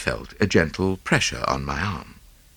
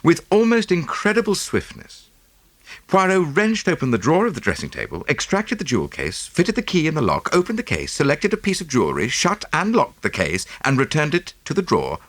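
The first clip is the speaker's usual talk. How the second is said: ordinary delivery, nothing marked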